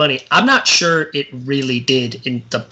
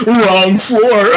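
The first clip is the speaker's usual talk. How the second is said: raised, louder one-liner as the trapped hero; deadpan movie quip; 6 semitones higher, 6 dB louder than usual. Retold or imitated. Retelling the elevator gag to cohosts, a mock hero quip. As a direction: toss off the line like an action hero's punchline. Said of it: "and he's like".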